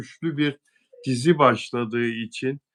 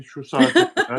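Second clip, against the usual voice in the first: laughing; 3 semitones higher, 5 dB louder than usual. Real, not acted. Amused repeat of the point.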